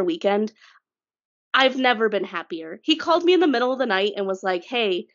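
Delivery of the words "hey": ordinary delivery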